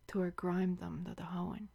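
"Tour grime dum da da hoen" in a soft unvoiced whisper. Shown as a demonstration. Showing her lover how to ask, in a seductive whisper. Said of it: "Say it like this".

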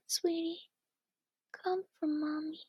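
normal talking voice

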